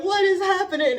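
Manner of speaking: high, loud, bewildered cry of mock disbelief; imitating crying people. Mimicking people who cry to her, high and bewildered. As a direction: voice someone overwhelmed and lost. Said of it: "they're just like".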